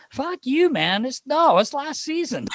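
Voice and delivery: very high-pitched mock protest; Phil Kessel. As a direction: imitate an indignant teammate backing out. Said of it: "He goes".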